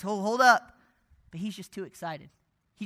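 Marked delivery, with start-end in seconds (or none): 0.0-0.8 coach calling. Retold or imitated. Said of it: "his coach goes like this".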